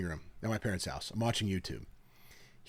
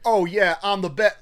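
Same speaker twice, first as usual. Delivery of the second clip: cocky boast; 9 semitones higher, 7 dB louder than usual. Retold or imitated. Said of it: "being like".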